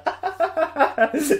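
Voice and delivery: vrai rire aigu qui échappe. Real, not acted. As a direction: pouffer de rire, haut perché. Rit de sa propre allusion. (fou rire aigu)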